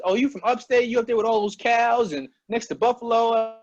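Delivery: high, teasing put-on voice of an outsider; mocking outsider. People mocking him for being from upstate, high teasing voice. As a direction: mimic someone sneering at upstate New York. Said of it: "so many people had their own opinions".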